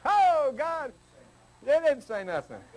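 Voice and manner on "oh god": mock panicked prayer. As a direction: play a frantic churchgoer calling on God. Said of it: "Al Benson".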